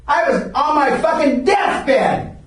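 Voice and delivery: slow, loud and high; outraged brag, dragging out the words; shouted boast. Real, not acted. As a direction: bellow it for the crowd. Boasting of his commitment.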